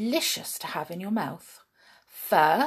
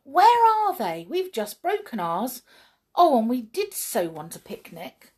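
higher, louder eager brownie voice; a small excited brownie; huffy little helper elf; 4 semitones higher, 5 dB louder than usual. Acted. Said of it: "he said".

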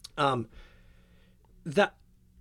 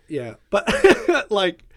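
laughing; 8 semitones higher, 13 dB louder than usual. Real, not acted. Laughing off the impression request.

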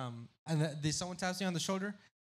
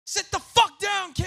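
very high, loud angry yell; angry shout; 13 dB louder than usual. Retold or imitated. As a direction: tell the kid to sit down. Rival fan yelling at him.

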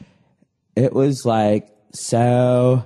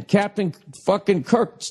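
normal talking voice

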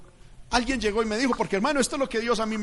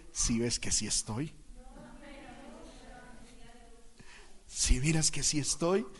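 God speaking to the heart; 9 dB quieter than usual. Acted. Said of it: "Dios me habló al corazón y me dijo".

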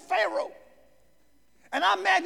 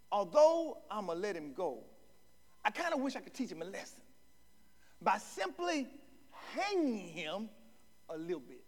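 soft, low, sly aside; quiet sly Lincoln; wry threat; 7 semitones lower, 12 dB quieter than usual. Retold or imitated. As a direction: let the joke land under your breath.

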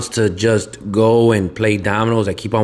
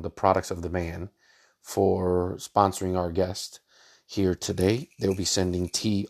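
much softer, unhurried ad read; sponsor read; 5 semitones lower, 10 dB quieter than usual. Acted. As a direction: read the thank-you to the sponsors calmly.

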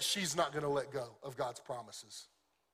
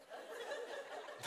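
real laugh; 13 dB quieter than usual. Real, not acted. Laughing at his own joke.

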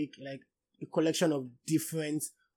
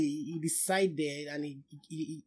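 ordinary talk

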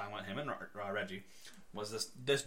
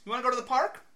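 higher, louder, impatient asking; louder repeat; 10 semitones higher, 8 dB louder, faster than usual. Retold or imitated. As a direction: ask again, losing patience. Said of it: "he repeats".

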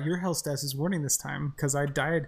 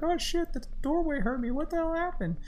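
much higher mock panic; mock bewildered; 13 semitones higher than usual. Mocking own confusion at game, high squeal. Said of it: "be like".

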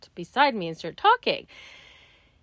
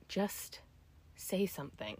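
inner voice; 12 dB quieter, slower than usual. Retold or imitated. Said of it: "conversation in my head where I'm like".

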